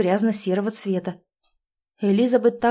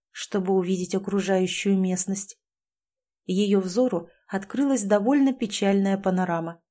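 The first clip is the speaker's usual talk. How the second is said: usual speaking voice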